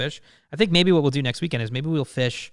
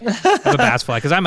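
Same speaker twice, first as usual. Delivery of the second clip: laughing; 9 semitones higher, 7 dB louder than usual. Real, not acted. Laughing after swallowing a fly.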